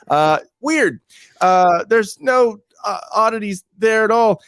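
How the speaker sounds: mock innocent, high and slow; sarcastic high. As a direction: feign total innocence, slowly.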